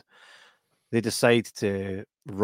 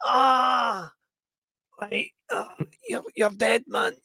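much higher, pained threat; shot man's threat; 13 semitones higher, slower than usual. Retold or imitated. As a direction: cry out then threaten, hurting. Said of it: "It's like".

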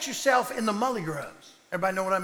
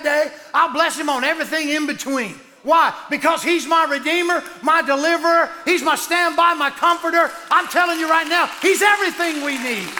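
excited loud preaching; getting loud; 4 semitones higher, 5 dB louder than usual. Real, not acted. Preacher gets excited praising his redeemer, real loud. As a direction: let it ring out, louder and louder. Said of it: "I get a little loud".

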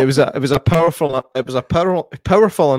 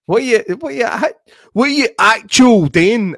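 mock Wayne slang; 10 semitones higher, 7 dB louder than usual. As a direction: take off the lads' slang. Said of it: "as the Waynes would say".

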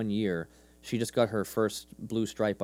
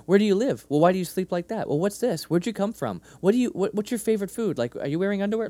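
put-on high, insistent nine-year-old's questions; rapid-fire questioning; 9 semitones higher, 4 dB louder, faster than usual. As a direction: imitate a curious kid firing off questions. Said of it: "twenty questions, rapid fire".

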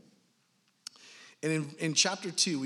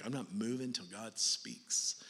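sulking; 3 semitones lower, 7 dB quieter, slower than usual. Retold or imitated. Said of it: "in a sitting there sulking way".

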